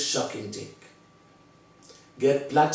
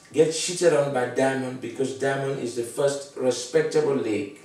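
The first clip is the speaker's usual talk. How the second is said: usual speaking voice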